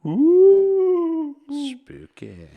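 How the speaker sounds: very high loud ghostly ooh; hammed-up spooky moan; mock spooky. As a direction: play at a ghost.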